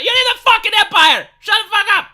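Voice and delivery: exasperated screaming rant; furious shout. Real, not acted. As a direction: blow up at them. Ranting at complainers.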